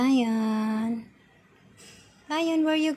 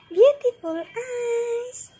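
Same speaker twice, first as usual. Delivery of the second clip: cooing praise; 11 semitones higher, 4 dB louder than usual. Real, not acted.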